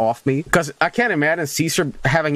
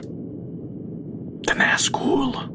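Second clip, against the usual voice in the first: breathy, slow, hushed; spooky whisper; 12 semitones higher, 5 dB quieter, much slower than usual. Pretend. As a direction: whisper it like a ghost story. Spooky naming of a monster.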